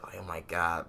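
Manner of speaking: normal talking voice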